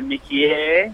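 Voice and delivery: slow practice "Mickey" in French, rising; tentative mock French; French accent. Shown as a demonstration. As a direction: stretch "Mickey" like a French word. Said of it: "practice saying Mickey in a French accent".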